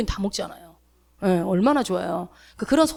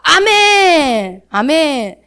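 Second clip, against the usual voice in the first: emphatic amen; 6 semitones higher, 7 dB louder, much slower than usual. Real, not acted. Closing amen after scripture.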